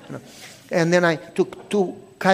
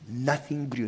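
usual speaking voice

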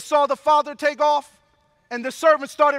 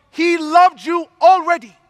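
louder, higher shout of the promise; climactic preacher shout; 5 semitones higher, 11 dB louder than usual. Acted. Preacher to the congregation, peaking in a loud cry. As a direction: lift it to the rafters. Peak of the sermon.

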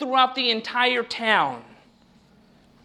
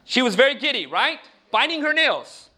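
ordinary talk

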